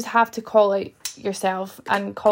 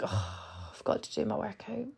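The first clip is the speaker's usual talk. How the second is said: tired groan; 2 semitones lower, 15 dB quieter than usual. Retold or imitated. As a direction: sigh it out as if exhausted after work. Tired inner voice after work.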